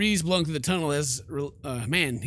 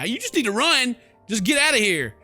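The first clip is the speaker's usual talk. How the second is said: raised, high-pitched urging to flee; urgent yell; 7 semitones higher, 6 dB louder than usual. Acted. Player urges the character out of there, urgent yell. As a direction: yell at them to get out.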